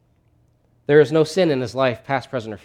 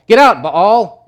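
high, shouted expulsion; exorcist's command; 8 semitones higher, 11 dB louder, slower than usual. Acted. Exorcist casting out the named demon, shouting. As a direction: cast it out with a shout. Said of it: "this priest who's an exorcist".

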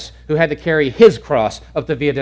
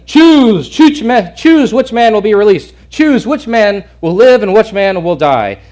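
loud, high commanding shout; Pilate shouting; 7 semitones higher, 8 dB louder than usual. Retold or imitated. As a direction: bellow the demand at the crowd. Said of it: "Pilate's telling them".

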